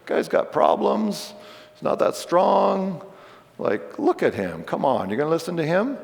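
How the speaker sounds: high, scornful mock of his critics. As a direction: sneer at him like the opponents. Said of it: "They say".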